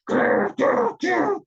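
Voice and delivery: loud, rolled storybook growls; tiger growl. A narrator's reading. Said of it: "growls the tiger".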